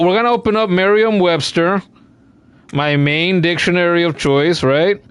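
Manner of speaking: usual speaking voice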